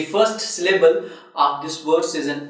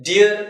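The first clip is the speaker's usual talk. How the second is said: DEAR hit higher and louder; stressed syllable; 4 semitones higher, 6 dB louder than usual. Shown as a demonstration. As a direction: put clear stress on "Dear". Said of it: "is again stressed syllable".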